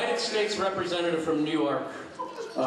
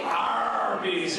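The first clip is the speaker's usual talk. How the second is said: shouted pirate "Arr" stretched into a name; 3 semitones higher than usual. Acted. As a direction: draw out the first syllable like a pirate. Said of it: "what restaurants pirates like to eat at".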